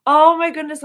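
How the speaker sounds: very high and loud delight; high thrilled exclamation; excited squeal. Real, not acted. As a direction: gasp it out thrilled. Delighted reaction.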